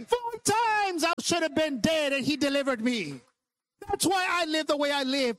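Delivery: high, sustained fervent testimony; impassioned preaching. Real, not acted. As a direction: preach with driving, unbroken fervor. Testifies at full preaching force.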